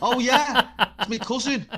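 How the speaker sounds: loud, high, delighted; excited delight. Retold or imitated. Telling the Magaluf story, excited delight. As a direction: burst out as if the coincidence is thrilling.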